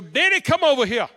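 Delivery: loud summons across a room; bossy call. Retold or imitated. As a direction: imitate an insistent woman calling out. Vanessa calling pastor over again.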